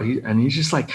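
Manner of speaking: ordinary talk, nothing marked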